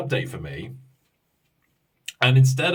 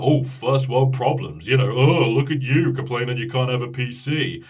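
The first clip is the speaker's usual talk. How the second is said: a hint of a sneering viewer, louder on the oh; mocking critic; 3 semitones lower, 3 dB louder than usual. Imagined viewer mocks his PC complaint, lightly sneering. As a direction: lightly mimic a scoffing commenter. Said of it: "And I know this is like".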